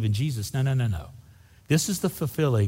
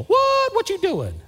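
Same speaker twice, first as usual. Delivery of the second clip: shrill, much higher mock gasp; Jesus as he does not sound, mock outraged; mock shock; 4 dB louder, slower than usual. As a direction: overplay a scandalized shock. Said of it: "Jesus doesn't go".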